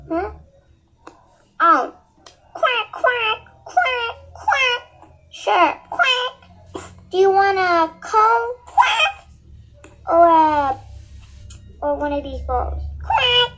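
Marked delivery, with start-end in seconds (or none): 2.5-4.8 higher, crying quacks like a little duck; duck quacking. Acted. Duck answers at ice cream stand. 5.9-6.3 duck quack. Acted. Duck answers at ice cream stand. 8.6-9.3 very high squeaky quack; one sharp duck quack. Acted. Duck answers about cone. 13.0-13.5 duck quack. Acted. Duck answers about bowls.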